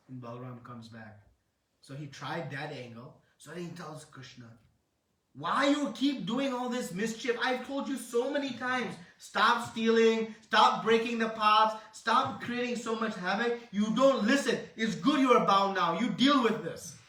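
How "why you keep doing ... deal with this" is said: much higher and louder scolding; chastising. Acted. Balaram scolding bound Krishna for his mischief, loud chastising. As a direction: scold a naughty little brother. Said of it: "he begins to chastise younger brother".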